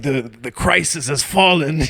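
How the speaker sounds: loud, high, drawn-out mock Russian accent. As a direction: proclaim doom as a hammed-up Russian. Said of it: "like, Russian dude".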